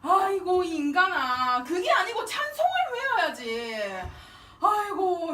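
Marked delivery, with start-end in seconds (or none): none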